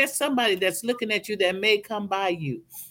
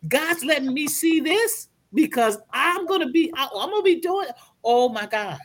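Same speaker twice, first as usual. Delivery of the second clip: mock starstruck gasp, pitched up high; starstruck gushing; 6 semitones higher than usual. Mentee imitates her own future fan, starstruck and gushing. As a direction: gush like a dazzled fan meeting a star. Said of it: "get starstruck about me and go".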